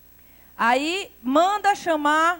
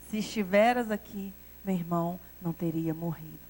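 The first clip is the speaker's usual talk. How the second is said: much softer and lower, reverent and humble; in adoration; 8 semitones lower, 8 dB quieter than usual. Retold or imitated. Preacher voicing Maria at Jesus' feet, softly adoring. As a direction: breathe it out, bowed at his feet. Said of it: "falou a mesma frase em adoração".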